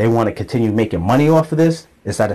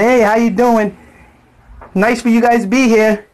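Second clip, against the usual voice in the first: high, sugary mock-friendly neighbor voice; fake friendly greeting; 9 semitones higher than usual. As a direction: play the two-faced neighbor, sweet on the surface. Mocking two-faced friendly neighbors.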